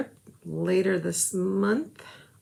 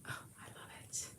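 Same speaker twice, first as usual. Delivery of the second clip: barely voiced, soft whisper; real hushed delight; 16 dB quieter, much faster than usual. Real, not acted. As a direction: breathe it out in awe. Admiring finished craft.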